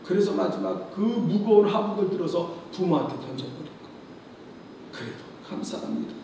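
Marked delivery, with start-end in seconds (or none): 4.9-6.1 unvoiced, quick whisper of thanks. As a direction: whisper it stubbornly. (속삭이는 감사)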